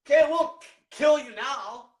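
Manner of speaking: trips over the words, then slows down; stuttering. Real, not acted. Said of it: "like, fucking stuttering".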